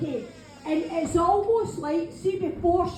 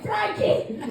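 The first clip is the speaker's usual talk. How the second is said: higher, louder call of the name; 6 semitones higher, 5 dB louder than usual. Retold or imitated. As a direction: call Frankie out like he shouted in. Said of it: "he's obviously just shouted in".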